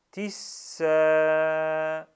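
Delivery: tisa loud, s doubled, a held long. Shown as a demonstration. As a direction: lean on the s, then hold the a in tisa. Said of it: "Je répète". (tisa avec s doublé et a prolongé)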